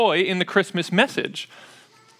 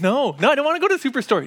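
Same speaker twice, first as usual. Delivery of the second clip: higher, fast mock whine; whiny kids; 7 semitones higher, faster than usual. Kids refusing to go to Superstore, fast higher whine. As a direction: rattle off a childish refusal. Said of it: "But they're like".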